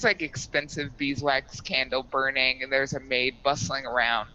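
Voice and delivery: normal talking voice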